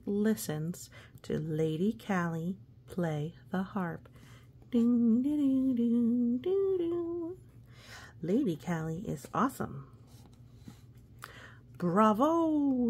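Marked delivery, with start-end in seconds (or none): 4.7-7.4 high, loud sing-song dings like a harp; harp chime. Acted. Imitating harp sound. 11.8-12.9 loud, high cheering bravo; yelling bravo. Acted. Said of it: "Sir Pete yells".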